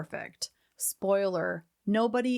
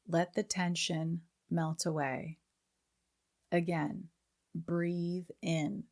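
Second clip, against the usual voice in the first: calm them down breath by breath; guided meditation; 4 dB quieter than usual. Acted. Leads a breathing exercise.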